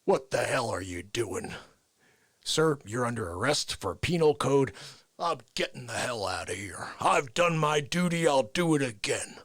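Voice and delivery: indignant colonel. Acted. Colonel facing arrest in acted scene.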